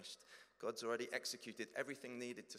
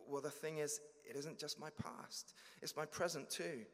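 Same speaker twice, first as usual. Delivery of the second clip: anxious confession; 6 semitones higher than usual. Acted. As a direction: plead nervously, afraid of rejection. Believer pleading.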